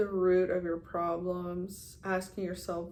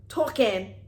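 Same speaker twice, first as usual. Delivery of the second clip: loud New Jersey attempt landing British; New Jersey accent gone British; 2 semitones higher, 7 dB louder than usual. Shown as a demonstration. Vlogger failing at New Jersey, sounding British. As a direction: punch out "talking", New Jersey slipping into British. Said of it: "I'm just sounding, like, British".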